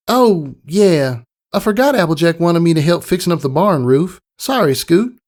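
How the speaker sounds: put-on a kid remembering her chores; sorry young pony girl; Apple Bloom.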